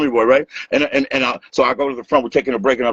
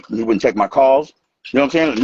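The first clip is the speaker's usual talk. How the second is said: normal talking voice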